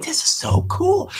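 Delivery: high, drawn-out slow wonder; amazed guest. Retold or imitated. New neighbors amazed by the mussels, high slow wonder. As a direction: stretch it out, high and wowed. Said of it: "They're like".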